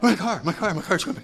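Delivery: high, loud, half-laughing panicked wail; mock panic. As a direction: play a man losing it over his car, gasping. Man panicking over lost car.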